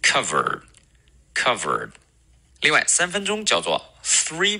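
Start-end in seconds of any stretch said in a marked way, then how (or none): 0.0-1.9 cover, cover slowly, with uh and a curled R; correct model. Shown as a demonstration. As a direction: leave room to repeat after each cover. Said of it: "大家再跟我来一遍".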